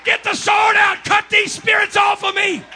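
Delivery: ecstatic shout. Acted. Calling out in worship.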